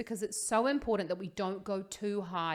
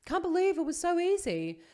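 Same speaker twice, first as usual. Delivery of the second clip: very high gushing student voice; imitated amazement; 10 semitones higher, 4 dB louder than usual. Imitating singers after they try warming up, high mock amazement. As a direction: exclaim high in mock disbelief. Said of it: "then they're just like".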